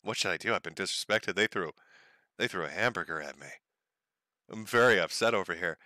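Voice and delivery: soft, low, lightly voiced pouty complaint; a hint of a hurt little boy; mock whiny Nick. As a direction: mumble it like a sulking kid. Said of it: "looks over at his boyfriend".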